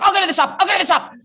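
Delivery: high, loud panicked repeat with a laugh; mock a flustered rep, high and loud. Comedian imitates the panicked Live Nation rep, high and loud. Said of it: "panicked and he was like".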